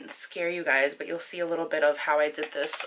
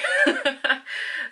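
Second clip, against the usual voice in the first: giggle; 8 semitones higher than usual. Real, not acted. Laugh after joke.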